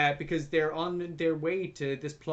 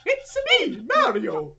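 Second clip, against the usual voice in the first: put-on Mario, Italian accent; 7 dB louder, slower than usual. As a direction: squeal it in a cartoon Italian accent. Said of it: "It's a stereotypical Italian accent".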